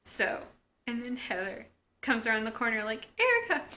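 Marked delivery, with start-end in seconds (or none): 3.2-3.7 calling out. Retold or imitated. Heather calling after her sister.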